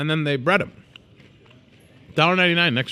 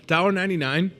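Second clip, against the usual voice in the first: ordinary talk, nothing marked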